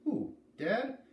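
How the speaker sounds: shocked little sister asking; voiced as a startled young girl.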